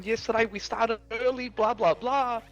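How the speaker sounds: high mocking voice for Hamza's rant. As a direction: mimic Hamza ranting, pitched high and sneering. Retelling Hamza going off.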